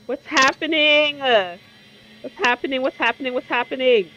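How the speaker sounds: loud, panicked repeating; jump-scared gamer asking what's happening. Real, not acted. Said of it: "I'm so scared right now".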